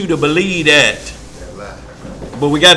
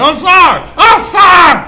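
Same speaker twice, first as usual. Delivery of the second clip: high, loud begging cry; desperate pleading; 8 dB louder than usual. Retold or imitated. Sinner shouting for mercy at judgment, pleading cry. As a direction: beg for mercy at full voice.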